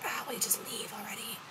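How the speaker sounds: whispered impatient aside; imitated grumbling. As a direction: whisper it like someone complaining behind a back. Girls behind grumbling, imitated.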